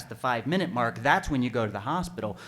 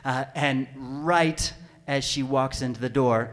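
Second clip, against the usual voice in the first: ordinary talk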